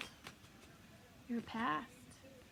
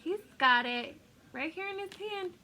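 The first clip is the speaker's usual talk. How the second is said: higher, louder excitement; excited higher voice; 7 semitones higher, 7 dB louder than usual. Real, not acted. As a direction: burst out excitedly. Excited card reading.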